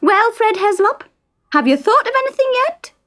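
high, quick impatient nag; nagging wife. A narrator's reading. Isa nagging sleepy husband in bed, high and fast. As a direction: shake him with the words. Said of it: "giving her husband a shake".

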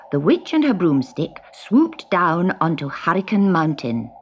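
plain reading voice

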